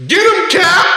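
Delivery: high, excited crowd yell; excited moviegoer. Retold or imitated. Theatergoer cheering on Cap at the screen, excited yell. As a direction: cheer your hero on like a moviegoer. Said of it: "some guy in the theater just goes".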